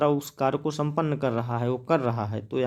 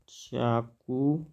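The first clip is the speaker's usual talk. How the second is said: slow dictation; 2 semitones lower, 4 dB quieter, much slower than usual. Shown as a demonstration. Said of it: "यहाँ लिख देते हैं थोड़ा सा".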